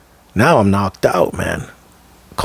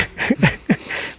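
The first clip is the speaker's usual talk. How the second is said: soft, high chuckle; quiet laugh at the listener's answer; real chuckle; 10 semitones higher, 6 dB quieter than usual. Real, not acted. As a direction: laugh it off softly. Laughs after listener says no.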